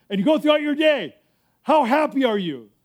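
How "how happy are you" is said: ordinary talk, nothing marked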